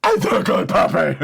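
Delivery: high, loud gushing squeal with a laugh; gushing girl impression. Mocking the girl petting the dog, high laughing squeal. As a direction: coo at the puppy in a squeal. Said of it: "she's like".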